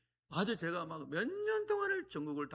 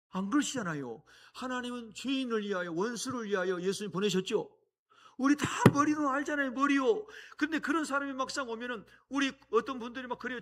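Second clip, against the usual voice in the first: much higher and louder, driving preacher voice; full-force preaching; 11 semitones higher, 11 dB louder than usual. Acted. Preacher urging the congregation to love enemies: high, loud preaching. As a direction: preach it hard to the whole room.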